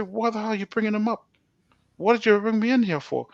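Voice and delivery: high-pitched angry retort, not shouted; angry questioning. Retold or imitated. Prisoner challenging the pastor who mentioned his enemy, retold angrily. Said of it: "I was so angry".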